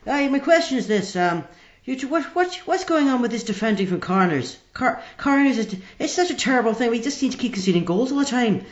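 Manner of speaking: annoyed Northern Irish fan on a phone-in; Northern Irish accent. Acted. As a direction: moan about corners in a broad Derry voice. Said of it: "I think the accent threw me slightly".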